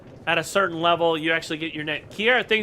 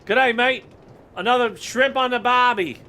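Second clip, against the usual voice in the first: put-on light Aussie accent, higher and brighter; hammed-up Australian accent; mock Australian accent; 6 semitones higher, 4 dB louder than usual. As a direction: play a cheerful Australian greeting. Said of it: "Went to Australia for a second".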